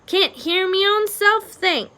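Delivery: high, loud, slow grumble; cursing complaint. A narrator's reading. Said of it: "Meg cursed".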